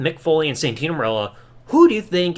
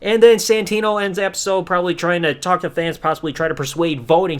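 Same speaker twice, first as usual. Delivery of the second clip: ordinary delivery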